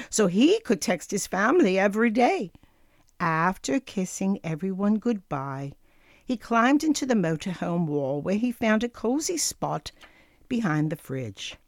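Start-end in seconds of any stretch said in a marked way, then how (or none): none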